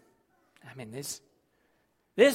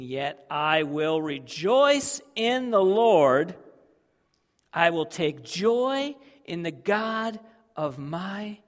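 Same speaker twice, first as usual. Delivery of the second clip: slow rejoicing; 3 semitones higher, 9 dB louder, slower than usual. Acted. Reading verse slowly.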